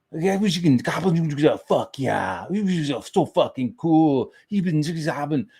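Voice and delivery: high mock-German babble; imitated German guy. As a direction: mimic a German gushing to his friend. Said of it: "the guy was speaking German next to us".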